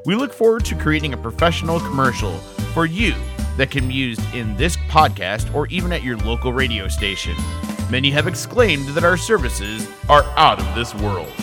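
upbeat, bright radio ad read; commercial read. Acted. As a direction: sell it like a radio spot. Reads ad for Star Productions.

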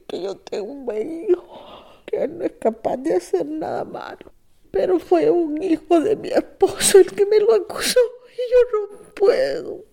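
real tears pushing the pitch up, slowing on the last words; breaking into tears. Real, not acted. Mother telling how her stepson accused her son, crying.

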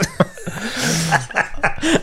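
speaking through laughter; laughing. Real, not acted.

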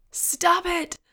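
high, piercing, desperate yell. A narrator's reading. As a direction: yell it at full pitch, pleading. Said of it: "Joanne yelled".

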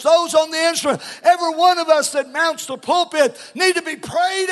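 high shouted preaching. Real, not acted. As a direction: build the list to a roar. Preaching climax, shouted list.

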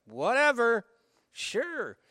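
high, offhand whatever; dismissive shrug. Acted. Fishermen humoring the stranger on shore, high dismissive. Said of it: "so it's like".

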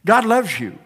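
ordinary delivery

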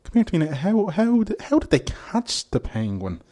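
high-pitched imitated bumbling local; squeaky mock old Dubliner asking; high mock Dublin man. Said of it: "he asked".

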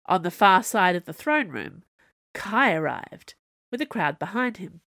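ordinary delivery

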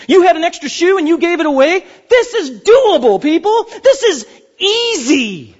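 high, loud, slow and emphatic, pressing the point home; this is doable, stretch it out; excited exhortation. Real, not acted. Preacher urging the congregation that serving is easy, excited.

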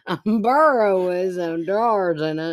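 slow slurred "bureau" mimicry; slurred drunk imitation. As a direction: mumble "bureau with some drawers" drunkenly. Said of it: "You got, like, so drunk".

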